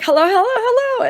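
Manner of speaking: high, loud sing-song hellos; Valerie Cherish greeting. Retold or imitated. Lisa Kudrow's character opening her trailer, in a chirpy sing-song. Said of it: "Lisa Kudrow ... is like".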